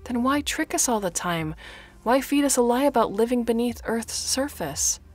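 high, loud accusation; angry. Acted. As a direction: demand answers angrily.

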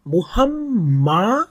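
মুহাম্মাদুন with the ম্ম held; slow recitation of মুহাম্মাদুন; hold the doubled m. Shown as a demonstration. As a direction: sustain the ম in মুহাম্মাদুন before letting go. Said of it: "মুহাম ধরে রাখতে হবে".

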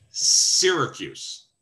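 Syracuse said slowly as SEER-a-cuse; correct pronunciation. Shown as a demonstration. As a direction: Syracuse with long clear SEER first syllable. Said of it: "Repeat after me".

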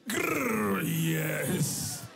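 hammed-up eager growl and yes. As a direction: growl like an impatient groom. Mimicking eager groom.

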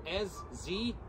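slow letter-by-letter spelling; careful dictation pace; spelled out slowly. Shown as a demonstration. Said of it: "it's spelled".

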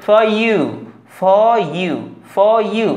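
"for you" as "faw you", repeated deliberately; R silent. Shown as a demonstration. Teacher drilling the correct pronunciation, R silent. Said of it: "এটার উচ্চারণ হবে".

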